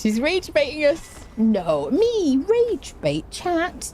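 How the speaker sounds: high, indignant mock denial; mock innocence. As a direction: play the wounded innocent. Mock outrage at rage bait claim.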